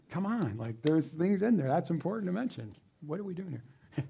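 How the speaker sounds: mock-frustrated, pitch swinging up; exasperated protest. Teacher complaining to AI about what it missed, exasperated. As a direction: protest what was left out, voice rising. Acted exasperation at missing detail.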